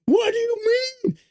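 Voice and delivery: shrill parody of a freaking-out critic; mock panicked leftist. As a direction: squeal like everything's burning. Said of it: "they start freaking out".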